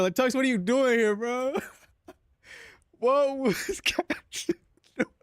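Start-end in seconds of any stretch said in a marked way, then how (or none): 3.0-5.1 words broken by real laughter; can't get it out for laughing; laughing through oh my god. Real, not acted. Laughing at the picture.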